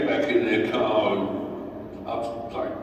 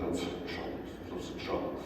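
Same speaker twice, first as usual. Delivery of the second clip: whispered mocking echo; 14 dB quieter, faster than usual.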